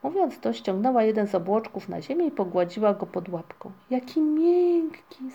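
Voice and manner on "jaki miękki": slow, high, drawn-out wonder; delighted exclamation. A narrator's reading. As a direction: marvel at it, stretching the words. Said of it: "zawołała".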